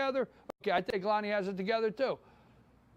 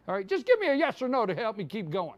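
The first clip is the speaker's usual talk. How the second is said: ordinary delivery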